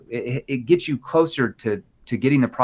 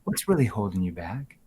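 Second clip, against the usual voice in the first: lower, concerned tone; 4 semitones lower, 8 dB quieter than usual. Shown as a demonstration. Agent asking the hesitant prospect, low and concerned. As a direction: lower and much softer, caring. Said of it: "See, lower tone, concern".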